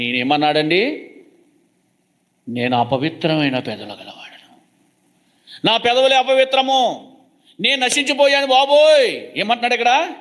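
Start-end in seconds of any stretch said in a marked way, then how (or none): 2.5-9.2 mock weeping. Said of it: "ఏడుస్తున్నాడంట, దుఃఖపడుతున్నాడంట".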